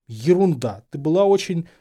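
loud start left hanging; embarrassed breaking off. A narrator's reading. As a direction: blurt it, then stop short. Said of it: "Гарри смущённо притих". (смущённо оборвав фразу)

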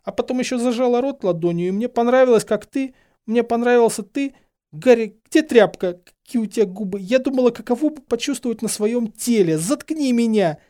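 high, tearful, spilling over; overwrought, pleading and high-pitched; distraught confession. A narrator's reading. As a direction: blurt it out desperately in a high voice. Hermione's tearful confession runs on.

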